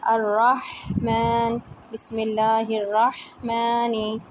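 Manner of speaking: teacher recites बिस्मिल्लाहिर्रहमानी slowly; slow recitation. Shown as a demonstration. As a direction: give the ह in अर्रहमान full time. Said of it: "पूरा time दें".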